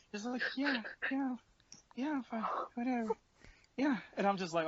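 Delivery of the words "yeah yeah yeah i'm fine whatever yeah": put-on high, dismissive drunk voice; drunk girl voice. Drunk friend voiced in story.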